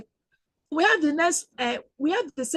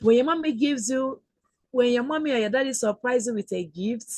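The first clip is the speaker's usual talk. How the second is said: ordinary talk, nothing marked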